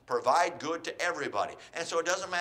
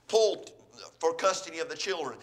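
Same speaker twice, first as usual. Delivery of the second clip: ordinary talk, nothing marked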